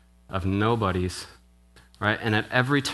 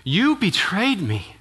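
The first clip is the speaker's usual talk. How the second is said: high, slow, hurt cry of a let-down follower; disbelieving disciple, pitched high and drawn out; betrayed disciple; 9 semitones higher, 4 dB louder, slower than usual. Pretend. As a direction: voice a disciple who feels betrayed. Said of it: "you're thinking".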